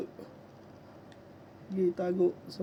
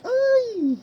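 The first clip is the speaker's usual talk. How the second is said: very high loud yelp; high alarmed yelp; 9 dB louder than usual. Real, not acted. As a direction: cry out in alarm. Alarmed cry during fight.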